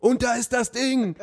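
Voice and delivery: high, excited commentator call; goal commentary. Pretend. Commentator moment at the toilet.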